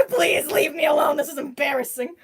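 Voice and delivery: loud, laughing plea to be left alone; mock shouted protest; embarrassed plea. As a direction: beg mom to back off, half-laughing. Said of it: "but I'm just like".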